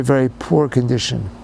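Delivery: ordinary talk, nothing marked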